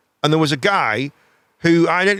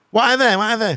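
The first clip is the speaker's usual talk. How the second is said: bored dismissal; 6 semitones higher than usual. Retold or imitated. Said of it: "And they go".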